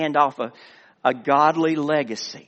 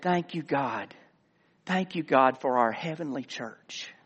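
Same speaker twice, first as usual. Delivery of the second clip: soft, hushed imitation of a child praying; lightly voiced, reverent little girl; tiny little girl's prayer; 2 semitones lower, 7 dB quieter than usual. Little girl praying at the crowded altar, hushed. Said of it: "tiny voice of a little girl".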